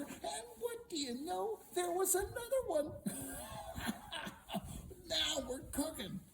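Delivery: high-pitched gleeful crowing with a laugh; gleeful find. Acted. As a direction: crow it high, laughing at your luck. Storyteller voices gleeful hero's find.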